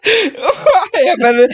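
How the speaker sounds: high, very slow, laughter-choked; crying with laughter. Real, not acted. Podcaster wanting to stop the episode, crying with laughter. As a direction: struggle to get the words out.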